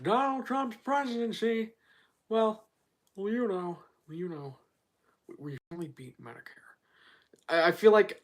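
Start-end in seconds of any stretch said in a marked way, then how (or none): 0.0-1.7 high politician impression; Joe Biden. Streamer retrying his debate bit, high Biden voice. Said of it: "I could do a good Joe Biden". 2.3-6.6 halting politician fading to a soft mumble; Joe Biden. Acted. Streamer retrying his debate bit, fading halting Biden. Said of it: "I could do a good Joe Biden".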